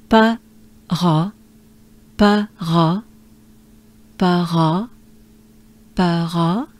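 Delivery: "pa, ra" as separate French sounds; syllables kept apart. Shown as a demonstration. Teacher drills the sounds before linking, syllables apart. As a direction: say "pa", then a breathed "ra". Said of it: "we could go first like this".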